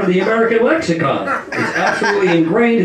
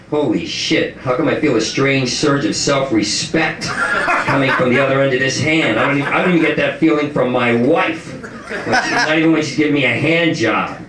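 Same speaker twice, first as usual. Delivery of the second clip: lower, amazed musing; 7 semitones lower than usual. Retold or imitated. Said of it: "go like".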